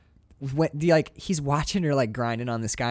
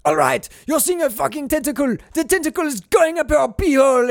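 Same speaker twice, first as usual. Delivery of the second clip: egging on the actor, pitched up; pushy director; 7 semitones higher than usual. Pretend. Director coaching Tim Robbins, mock pushy.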